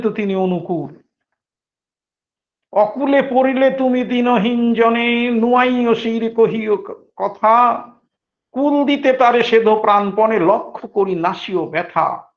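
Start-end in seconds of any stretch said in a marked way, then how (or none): 2.7-12.3 higher, slow chanted verse; poem recitation. Acted. Preacher reciting a devotional verse to listeners, reverent.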